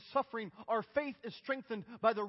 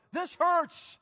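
loud, higher pained cry; 7 semitones higher, 6 dB louder than usual. Retold or imitated. A wounded believer crying to the Father, loud and raw. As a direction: lift it as a raw plea. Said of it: "cry out directly to the Father".

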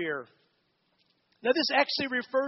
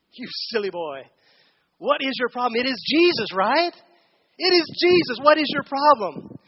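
exasperated scolding; 2 semitones higher, 7 dB louder than usual. Retold or imitated. Preacher mocking Peter's doubt, mild exasperated scolding. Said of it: "say something like this".

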